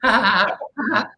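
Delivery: nervous laugh. Retold or imitated. Magician stuck between two arguing men, nervous forced laugh. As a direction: laugh it off awkwardly while they fight. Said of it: "And I'm nervous".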